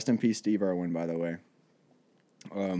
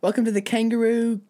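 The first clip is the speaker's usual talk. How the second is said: high, hammed-up Australian accent; badly faked Australian, pitched up; 9 semitones higher than usual. Australian fumbling a seduction line, high Australian accent. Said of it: "I'm from Australia".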